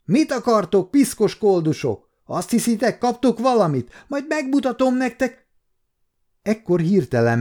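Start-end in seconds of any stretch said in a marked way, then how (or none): 0.0-5.5 high-pitched scolding shout, held in volume; threatening, indignant cry in a raised voice; angry yell. A narrator's reading. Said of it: "és rájuk ordított".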